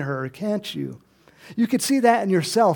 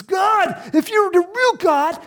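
high, loud call to God; bold challenge; 10 semitones higher, 6 dB louder, slower than usual. Retold or imitated. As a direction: Elijah daring God before the crowd. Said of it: "He says".

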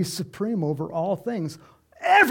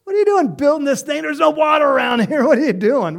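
much higher, loud laughing jeer; mocking jeer; 10 semitones higher, 9 dB louder than usual. Mocking crowd shouts at Noah building the ark, laughing. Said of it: "were laughing at Noah, going".

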